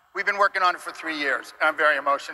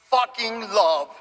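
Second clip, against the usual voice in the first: slow, punched, higher emphasis on fucking love; emphatic love; 5 semitones higher, 3 dB louder, slower than usual. Real, not acted. Director telling fans his studio's passion, emphatic. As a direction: lean hard into fucking love. Passionate claim about games.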